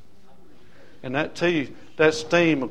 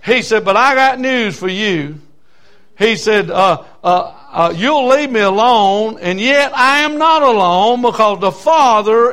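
loud, high preaching at full volume; full-force preaching; 7 semitones higher, 9 dB louder than usual. Acted. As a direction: preach it hard to the congregation.

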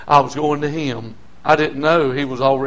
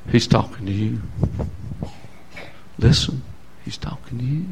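soft, low voice fading into a whisper; inner voice; 6 semitones lower, 6 dB quieter than usual. Retold or imitated. Pastor voicing the heart's prompting, hushed to a whisper. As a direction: murmur it like a conscience nudging you. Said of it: "in our heart we say".

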